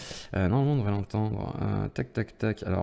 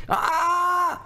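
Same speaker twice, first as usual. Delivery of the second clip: startled yell. Real, not acted. Sudden cry as the bear connects.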